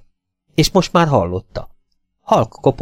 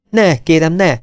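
a mild, slightly raised protest; 7 semitones higher, 3 dB louder than usual. A narrator's reading. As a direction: push back gently but urgently. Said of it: "tiltakozott a lány". (enyhén felemelt hangú tiltakozás)

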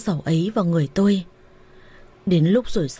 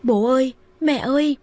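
panicked scream; 6 semitones higher than usual. A narrator's reading. Wife calling in-laws for help, high panicked shout. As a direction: shout for the parents in terror. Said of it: "Tôi hoảng quá hét ầm lên".

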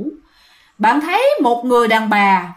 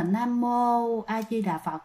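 hushed, slow Buddhist chant; silent prayer voiced softly; 10 dB quieter, slower than usual. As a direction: recite it under your breath, slowly. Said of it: "niệm Phật thầm ở trong tâm".

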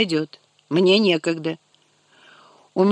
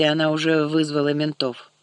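plain reading voice

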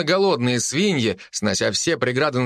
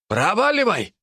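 shouting; 9 semitones higher than usual. Acted. Worker shoos pig.

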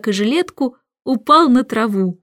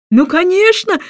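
high, loud gleeful exclamation; the king bursting with pleased excitement; delighted cry; 9 semitones higher, 4 dB louder than usual. A narrator's reading. King cries out, thrilled by his success with the professor. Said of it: "вскричал король, обрадованный".

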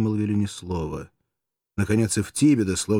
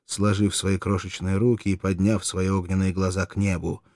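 plain reading voice

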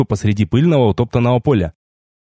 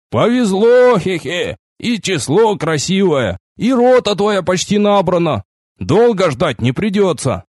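high, drawn-out congratulation with a chuckle; chatty quartermaster; 6 semitones higher, slower than usual. A narrator's reading. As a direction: tease the recruit about his luck. Lively quartermaster chuckles at recruit's luck. (оживлённый снабженец с хохотком)

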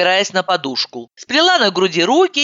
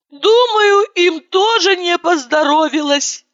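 wraith; 4 dB louder, slower than usual. Acted. Continues the grave pronouncement.

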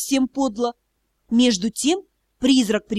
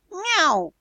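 sad meow; 4 semitones higher than usual. A narrator's reading. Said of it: "печально вздохнул".

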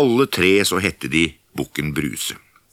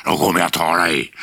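troll shout; faster than usual. Acted. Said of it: "skrek trollet".

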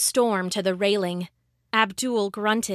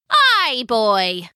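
salty pirate woman; 4 dB louder than usual. A narrator's reading. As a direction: give it a seasoned old pirate's lilt.

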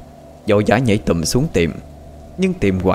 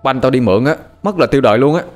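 put-on frantic child's shout; panicked cry; 5 semitones higher, faster than usual. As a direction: yell out in alarm over the lost ball. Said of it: "Một đứa thất thanh".

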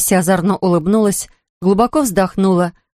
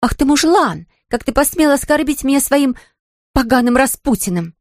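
higher, mock-indignant empress outburst; a hammed-up outraged empress; indignant roar; 6 semitones higher than usual. A narrator's reading. Lucy as empress scolding Cecil, higher mock indignation. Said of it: "возмущённо взревела Люся".

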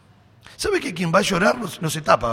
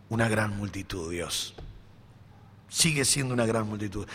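much lower and softer, hushed before God; humble prayer; 7 semitones lower, 9 dB quieter than usual. Retold or imitated. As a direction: confess it quietly and humbly. Josafat humbly admits to God.

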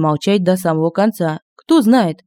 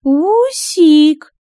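high, loud coaxing witch call; 9 semitones higher, 4 dB louder than usual. A narrator's reading. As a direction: call him sweetly across the room, high. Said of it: "донеслось призывно от стола".

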